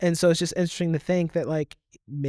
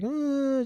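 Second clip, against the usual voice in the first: put-on whining toddler; 11 semitones higher than usual. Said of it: "just kinda whining".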